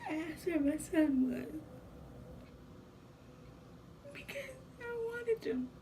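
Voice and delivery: high, tearful voice breaking into a whispered sob; real tears as she relives almost losing her son; crying. Real, not acted. Mother recalls giving up baby.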